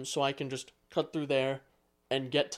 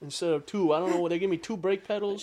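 high, loud, hurried comic complaint; baffled customer; 5 semitones higher, 5 dB louder than usual. Acted. Playing a car owner complaining about extra pedals, baffled. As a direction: play a flustered customer, pitched up and rattling along.

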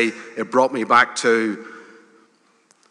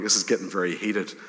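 muttered aside; 4 semitones lower, 8 dB quieter, faster than usual. Retold or imitated. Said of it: "And you're going".

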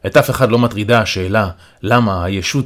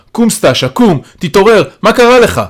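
loud call climbing higher; calling out urgently; 10 semitones higher, 7 dB louder than usual. A narrator's reading. Calling to collapsed Stasha to get up, urgent calling. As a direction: urge someone to wake up, louder each phrase. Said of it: "אני קורא אל סטאשה".

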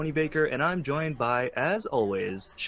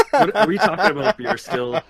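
laughing surprise; 5 semitones higher, 7 dB louder than usual. Real, not acted. Host catches the pregnancy hint.

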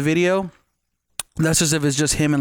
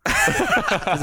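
laughing. Real, not acted. Laughing at the show.

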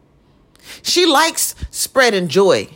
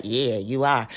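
mock snide aside; 12 semitones lower, 6 dB quieter than usual. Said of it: "probably like".

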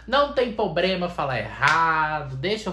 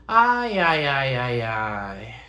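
mantra sighs; 5 dB louder, much slower than usual. Shown as a demonstration. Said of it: "É um mantra".

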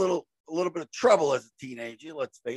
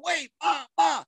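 pleading yell; 10 semitones higher, slower than usual. Retold or imitated. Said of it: "So I'm going".

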